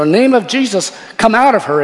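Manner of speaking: loud, high, forceful order to a spirit; acted exorcism command. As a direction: order the demon out, re-enacted. Acts out third exorcism command.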